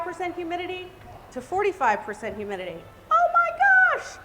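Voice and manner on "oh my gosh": high, drawn-out oh my gosh; real delight, pitched way up; excited exclamation. Real, not acted. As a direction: gush about the result, slowly. Amazed at humidity drop.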